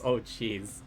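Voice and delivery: ordinary delivery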